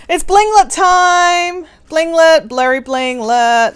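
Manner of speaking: slow, loud sing-song call; excited announcement. Real, not acted. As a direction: announce it like a game show host. Announcing the giveaway segment.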